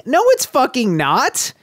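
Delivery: high, exasperated objection; indignant rant. Real, not acted. Co-host ranting against the author's claim, indignant and high. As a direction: push back hard on the claim.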